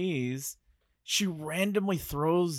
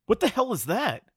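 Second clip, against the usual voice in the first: startled shout, higher and louder; 8 semitones higher, 5 dB louder than usual. A narrator's reading. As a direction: shout it out in alarm. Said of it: "Steve suddenly shouted".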